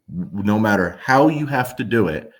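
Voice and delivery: normal talking voice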